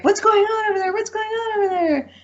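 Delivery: high-pitched alarmed sister voice; worried sister. Retold or imitated. Sister on video chat hears yelling, high alarmed voice. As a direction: imitate the sister panicking on the phone.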